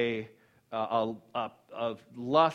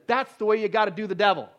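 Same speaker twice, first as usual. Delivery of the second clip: lightly raised, excited shout; 5 semitones higher, 5 dB louder than usual. Retold or imitated. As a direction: call it out on impulse. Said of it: "he just shouted out".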